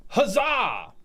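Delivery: high, loud triumphant cheer; a big celebratory huzzah. Real, not acted. Cheering a chatter's news.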